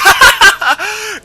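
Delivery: very high, loud put-on laugh; hammed-up cackling laughter; mock guffaw. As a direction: burst into an exaggerated imitated laugh. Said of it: "And he's like".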